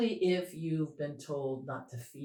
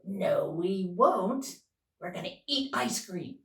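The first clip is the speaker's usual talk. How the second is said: defiant inner rebel; 4 semitones higher, 3 dB louder than usual. Acted. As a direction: dig in like a rebellious child. Voicing the rebellious inner part.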